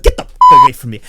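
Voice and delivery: mock-angry shove-off that jumps up loud; disgusted outburst. Recoiling from his own body in the shower, disgusted outburst. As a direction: burst out in loud, high revulsion.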